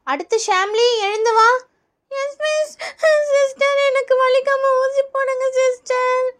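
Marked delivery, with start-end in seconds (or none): 2.1-2.7 high, soft schoolkid answer; timid little-kid reply; child pupil. Acted. Pupil answering the teacher's call, soft high child voice. 3.3-6.3 high, slow tearful whine; crying child. Acted. Pupil begging nurse for painless injection, crying. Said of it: "எதுக்கு இப்படி அழுவுற?".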